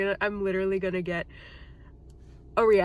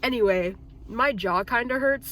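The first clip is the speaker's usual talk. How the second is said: usual speaking voice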